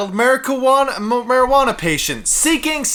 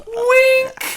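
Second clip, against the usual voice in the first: high squeaky wink; 3 dB louder than usual. Pretend. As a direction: jump way up on wink. Joking wink.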